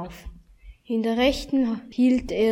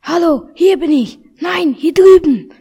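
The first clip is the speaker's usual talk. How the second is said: put-on higher and louder, a little boy calling out; small boy calling; 6 semitones higher, 11 dB louder than usual. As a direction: call out to be found, high and loud. Tiny hidden boy calls out.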